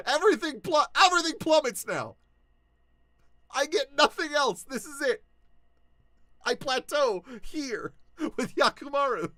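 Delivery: high, loud hammed-up despair; mock doom. Joking to fans his career peaked, in high hammed-up despair. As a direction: wail it like it's all downhill from here.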